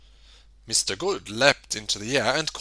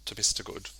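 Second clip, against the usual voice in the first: usual speaking voice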